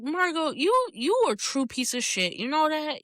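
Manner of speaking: high, appalled scolding; indignant scolding. Retold or imitated. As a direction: call Margot out to her face. Telling off Margot.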